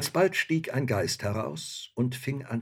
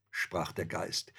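usual speaking voice

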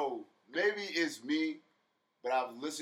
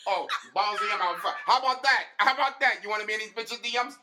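higher, louder laughing mock [name]; [name] impression; 7 semitones higher, 5 dB louder than usual. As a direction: cackle through a put-on [name] taunt. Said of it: "My Spanish voice".

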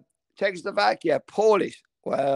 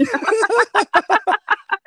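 loud, high-pitched laughing fit; big, squealing laugh; helpless laugh; 5 dB louder than usual. Real, not acted. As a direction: burst out laughing at yourself. Laughs at own predicament.

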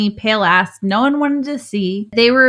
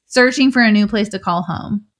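ordinary talk

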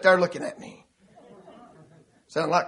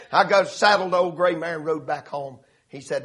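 ordinary delivery

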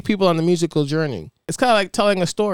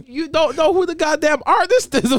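mock retort; 12 semitones higher than usual. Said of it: "I'm like".

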